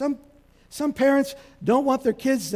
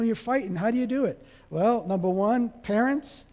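normal talking voice

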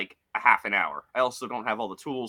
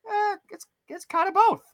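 much higher, shrugging and offhand; shrugging doctor voice; 5 dB louder than usual. Retold or imitated. Doctor shrugging off a patient's question, high and offhand. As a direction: toss it off like it's no big deal. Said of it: "And he's like".

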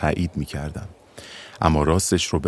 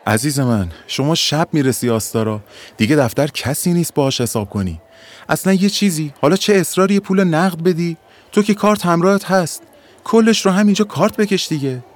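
high, louder pleading-insistent clerk; exasperated ticket clerk; 10 semitones higher, 4 dB louder than usual. Acted. Clerk to stubborn passenger, exasperated raised voice. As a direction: push the card payment, rising in pitch and force.

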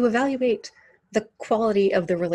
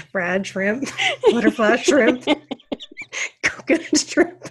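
giggling while listing; words cracking into laughs; laughing through words; 4 semitones higher, 4 dB louder, slower than usual. Pretend. Shrimp list breaking into laughter.

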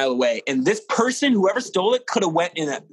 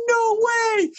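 amazed; 3 dB louder than usual. Retold or imitated. Manager hearing the news.

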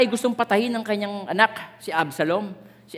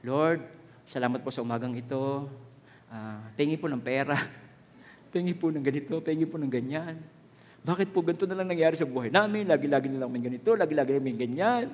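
a hint of a complaining, begging pray-er; mock a greedy, grumbling prayer, lightly; whiny prayer; 6 semitones lower, 6 dB quieter than usual. Preacher mocking a selfish prayer, lightly whiny. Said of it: "Hindi yung".

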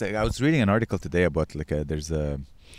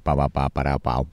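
low, clipped pow pow noises; mock gunfire; 7 semitones lower, faster than usual. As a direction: fake the shots with your mouth. Imitated gunshots.

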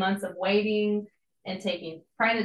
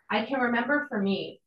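usual speaking voice

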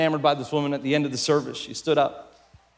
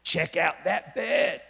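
hushed, slow Australian aside; soft conspiratorial Aussie, vowels stretched; 6 dB quieter, slower than usual. Acted. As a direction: mutter it slowly in a broad Aussie accent. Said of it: "he goes".

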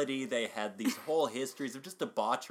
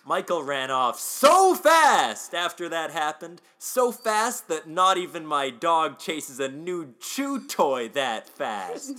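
SO FAST stretched out and much louder; dramatic over-emphasis; 4 semitones higher, 10 dB louder, slower than usual. Shown as a demonstration. Host dramatically reads the corrected joke, hammed-up emphasis on "so fast". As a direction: belt out "so fast" with huge, slow, drawn-out emphasis. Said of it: "when emphasized correctly and a few extra syllables".

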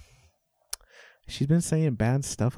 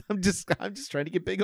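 ordinary talk, nothing marked

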